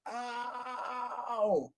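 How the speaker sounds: high sharp mock yelp; yelp of pain. Drunk bitten by the dog, high yelp of pain. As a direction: cry out like you just got bitten.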